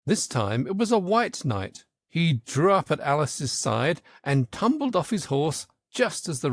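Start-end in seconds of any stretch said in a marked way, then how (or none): none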